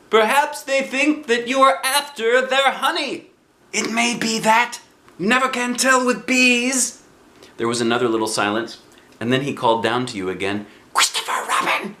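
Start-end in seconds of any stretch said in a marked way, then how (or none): 0.1-3.3 lightly voiced boy. Christopher voiced. 3.7-7.0 high, bright bear voice calling out; Pooh's cheerful cry. Acted. Pooh voiced. 10.9-12.0 breathy whispered call of a name. Acted. Pooh calls again secretly.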